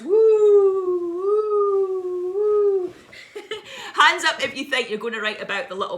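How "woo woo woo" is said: very high, loud ghostly wooing. Pretend. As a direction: wail like a ghost. Haunted trousers ghost noise.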